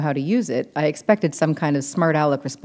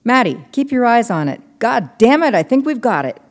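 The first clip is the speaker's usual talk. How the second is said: higher, louder, urgent; excited; 8 semitones higher, 4 dB louder than usual. A narrator's reading. Ellis urging Maddie during the sighting, excited. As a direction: push her to keep watching.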